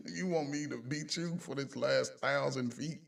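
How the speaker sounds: normal talking voice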